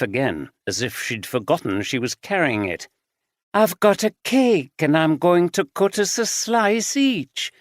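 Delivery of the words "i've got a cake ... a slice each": higher, sweetly smiling. A narrator's reading. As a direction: say it with an eerie smile. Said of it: "she said with a smile".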